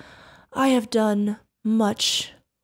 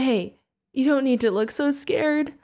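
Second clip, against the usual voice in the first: ordinary delivery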